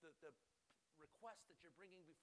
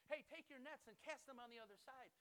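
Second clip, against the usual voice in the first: raised voice, higher pitch; calling out; 6 semitones higher, 4 dB louder than usual. Acted. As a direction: hail a boat from the shore. Voice calling from the shoreline.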